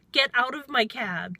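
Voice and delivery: 높고 큰 단호한 명령; 문을 가리키듯 내쫓는 말투; angry order. Retold or imitated. Ordering passenger out.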